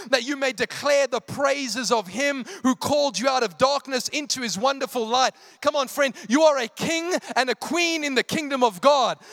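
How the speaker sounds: loud, high, drawn-out preaching; full-force preaching. Real, not acted. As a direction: preach it with urgent conviction.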